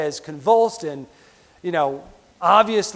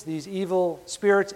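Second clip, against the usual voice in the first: normal talking voice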